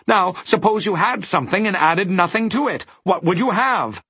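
high-pitched, brisk teacher figure; explaining dodecahedron. A narrator's reading. Dodecahedron teaching Milo arithmetic, assured. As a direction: quiz in a very high character voice.

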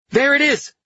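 loud, very high shout; excited cry. A narrator's reading. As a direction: cry out the sighting at the top of the voice. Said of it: "Carletto cried".

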